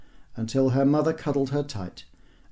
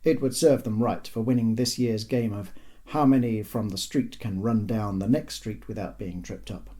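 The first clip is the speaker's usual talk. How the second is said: ordinary delivery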